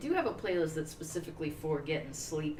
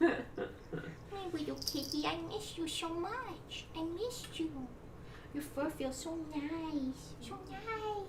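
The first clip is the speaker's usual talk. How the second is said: very high, slow, soft pet voice; 10 semitones higher, 6 dB quieter, much slower than usual. Real, not acted. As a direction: dote on a cat in tiny drawn-out tones. Cooing baby talk to the cat.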